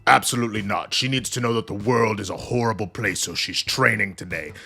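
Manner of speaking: stern authority, lightly put-on voice; imitation of a firm, commanding leader; Magneto. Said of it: "Magneto goes".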